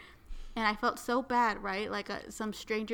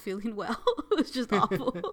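laughing through the words; 4 dB louder than usual. Real, not acted. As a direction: chuckle while saying it. Laughing at own misery.

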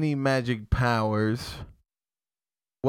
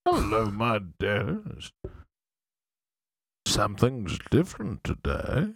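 Magneto voice; 6 semitones lower than usual. Pretend. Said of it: "I would transform into Magneto".

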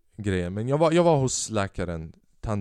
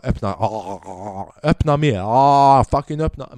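imitating a dentist who gets louder and pushier; impatient dentist; 2 semitones higher, 9 dB louder than usual. Dentist to patient in the chair, mock-impatient shout. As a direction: bark open wider, getting fed up.

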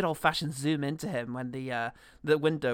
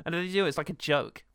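ordinary talk

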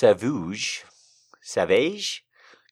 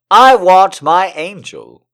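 loud, higher, slow passionate reading; dramatic declaiming; 8 semitones higher, 14 dB louder, much slower than usual. Acted. Streamer reading a love letter aloud, loud passionate declaiming. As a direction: proclaim each want to the heavens. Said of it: "Gotta reset".

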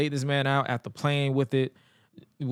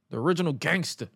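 ordinary delivery

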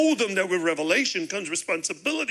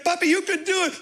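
put-on higher child voice urging Papa; little son cheering; 12 semitones higher, 4 dB louder than usual. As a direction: play the young son egging dad on. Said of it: "he's like".

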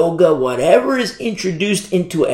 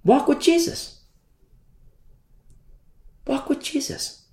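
high, hushed plea, softer on the repeat; earnest urging; 12 semitones higher, 4 dB quieter than usual. Real, not acted. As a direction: urge them gently in a raised pitch. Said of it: "I say that to urge you".

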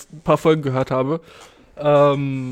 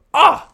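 kurzer hoher lauter Ausruf; amüsierter Aufschrei; 13 semitones higher, 7 dB louder than usual. Real, not acted. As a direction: vor Lachen aufquieken. Laughs about Ersatzbrot. (lauter hoher Lacher)

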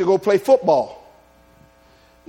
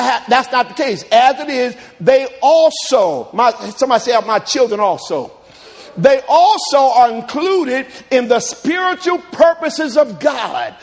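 rising sermon cadence, loud and drawn out; preaching; 5 semitones higher, 5 dB louder, slower than usual. Acted. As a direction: preach it, stretch every word. Preaching at full force.

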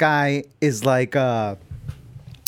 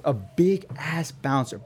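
ordinary delivery

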